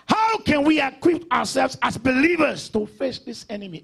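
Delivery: loud, rousing pulpit question; full-force preaching. Real, not acted. Pastor rallying believers against the enemy, forceful preaching. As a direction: throw the question out to the congregation.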